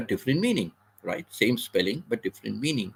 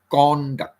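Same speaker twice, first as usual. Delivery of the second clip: "Conduct" with a loud, higher, drawn-out CON; first-syllable stress; 5 semitones higher, 6 dB louder than usual. Shown as a demonstration. Teacher isolates the noun, CON of "Conduct" stressed hard. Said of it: "we stress on the first syllable".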